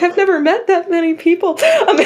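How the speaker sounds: high, laughing protest; amused, overwhelmed disbelief. Retold or imitated. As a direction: squeak it out through a laugh. Quoting herself, laughing.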